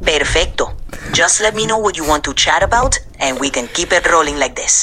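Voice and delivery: easy Puerto Rican-accented Spanglish; Spanish-tinged English in a Puerto Rican accent. Shown as a demonstration. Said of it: "can you just throw in some Spanglish?".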